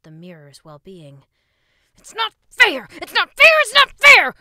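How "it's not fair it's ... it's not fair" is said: loud high whining shout; tantrum yelling. A narrator's reading. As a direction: throw a full tantrum. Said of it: "working on his tantrum".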